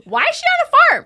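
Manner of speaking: very high, loud put-on English voice; mock English viewer. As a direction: shriek it like a scandalised Brit.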